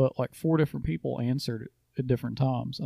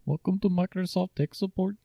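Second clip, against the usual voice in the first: put-on scam call center agent, Indian accent; mock Indian tech support accent; 7 semitones higher than usual. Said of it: "Give me an Indian accent".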